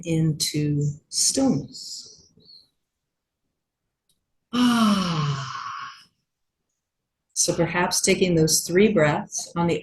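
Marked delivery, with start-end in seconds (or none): 4.5-5.5 long sighed "ah" on the out-breath; falling sigh of release; audible exhale. Shown as a demonstration. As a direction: let the breath out in an open, drawn-out sigh. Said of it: "making that audible".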